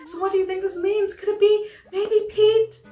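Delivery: high, hopeful lovestruck voice; Pelly. Acted. Pelly asks friend about Pete, high and hopeful. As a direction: wonder hopefully in a high character voice.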